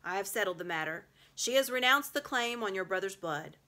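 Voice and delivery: put-on lion telling the children of the settlement. A narrator's reading. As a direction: announce it with authority. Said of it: "he said".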